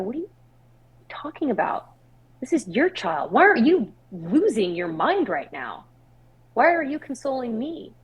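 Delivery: retold disbelief with big swooping pitch; incredulous outburst. Weeping aunt challenges the baby's calm mother, incredulous. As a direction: question it in exasperation, pitch leaping on the key words.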